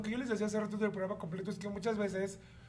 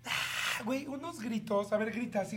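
ordinary delivery

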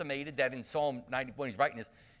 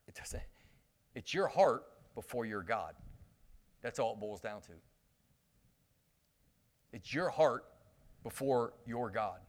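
quiet sincere appeal; 4 semitones lower, 4 dB quieter than usual. Real, not acted. Quiet earnest appeal to congregation.

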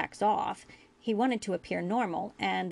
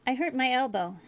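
lightly voiced boy, pitched up; hurt boy; 5 semitones higher, 3 dB louder than usual. A narrator's reading. Injured boy tells the doctor, lightly voiced boy.

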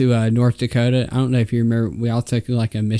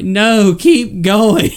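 high, loud brush-off; mock dismissive shout; 10 semitones higher, 7 dB louder, much slower than usual. Kids on the bus yelling at the leaders to keep going. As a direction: wave it away loudly.

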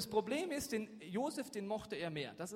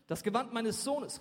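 loud, higher narrated cry; raised voice, dramatic reading; calling out; 6 semitones higher, 8 dB louder than usual. A narrator's reading. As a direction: cry out in shock as a father reading the news. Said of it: "rief er".

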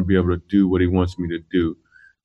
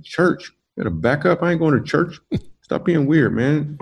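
high-pitched, disbelieving brush-off; incredulous refusal; 6 semitones higher than usual. Retold or imitated. Turning down the club manager's church invite, incredulous and high. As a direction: scoff at the church invite like it's absurd. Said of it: "I was like".

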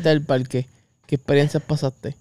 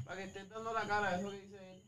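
mock echo; 5 semitones higher, 18 dB quieter, faster than usual. Friend echoing in odd voice.

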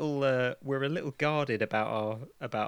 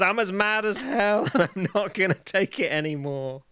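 loud mock yell out the window; 7 semitones higher, 7 dB louder than usual. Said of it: "pokes their head out and yells".

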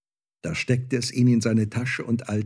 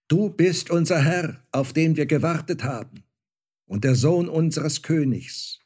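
high, raised proclamation of homage; reverent priests; 6 semitones higher, 4 dB louder than usual. A narrator's reading. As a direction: hail your lord before the crowd. Priests hail the Star-Child as lord. (feierliche Huldigung mit erhobener Stimme)

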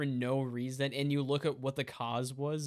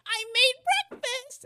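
high-pitched, louder mock mom voice; annoying sitcom mom; 5 dB louder than usual. Friend mocking an annoying sitcom mom, high loud voice. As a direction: mimic an irritating TV mom up high. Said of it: "She's like".

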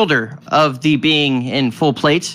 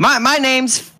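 higher little-man exclamation; little bald man; 8 semitones higher than usual. Acted. Tiny man addressing the travelers, higher character voice. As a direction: blurt it as a tiny fellow.

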